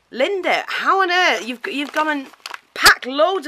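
high, swooping disbelief at a friend; amazed exclamation. Real, not acted. Marvels at friend's elaborate parcel.

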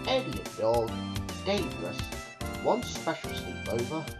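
plain reading voice

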